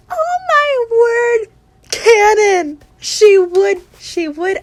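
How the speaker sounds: loud, high, emphatic fan excitement; thrilled outburst. Real, not acted. Fan declaring the imagined scene canon, thrilled outburst. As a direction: burst out as if it's confirmed.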